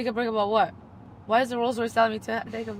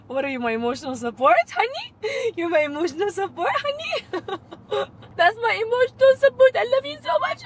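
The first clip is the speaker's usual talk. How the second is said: much higher, squealing through giggles; laughing gush; 8 semitones higher than usual. Real, not acted. Laughing lovingly at her caring Rolls Royce, squealing. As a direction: coo and laugh at the car like a sweetheart. Gushing over car's break message.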